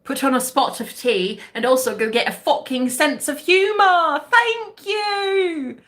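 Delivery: hammed-up English accent, rising to a high sign-off. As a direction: play it very English, stretch the final thanks. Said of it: "work on my English accent".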